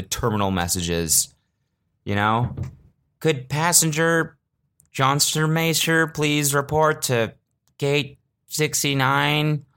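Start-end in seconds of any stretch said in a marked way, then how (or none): 3.2-9.7 slow, higher announcer voice; drawn-out PA page; airport announcement. Retold or imitated. Imitating terminal messages.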